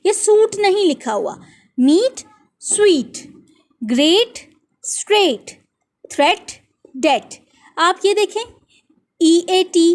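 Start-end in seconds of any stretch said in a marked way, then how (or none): 1.8-3.2 मीट and स्वीट with a long "ee", spaced apart; rhyming pronunciation. Shown as a demonstration. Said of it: "मीट की जो sound होगी". 3.8-5.6 ग्रेट rising, स्ट्रेट falling, same "ay" vowel; rhyming pronunciation. Shown as a demonstration. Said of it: "ग्रेट जिस word से rhyme करता है". 6.1-7.4 थ्रेट and डेट with a short "e"; rhyming pronunciation. Shown as a demonstration. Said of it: "थ्रेट जिस word से rhyme करता है".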